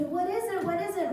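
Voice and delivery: high-pitched imitation of startled children; mock alarmed kid voice. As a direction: ask twice, high and urgent. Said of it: "they're all startled".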